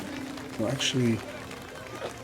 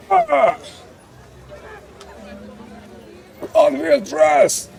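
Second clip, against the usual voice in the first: shout; much slower than usual. Acted. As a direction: roar your clan's name. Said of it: "he shouts".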